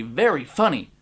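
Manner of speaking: ordinary delivery, nothing marked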